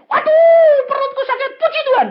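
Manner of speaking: very high-pitched mock wail of pain; acted pain. Woman in church praising through stomach pain, acted wail. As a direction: cry out as if the belly hurts.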